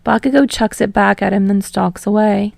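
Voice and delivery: ordinary delivery, nothing marked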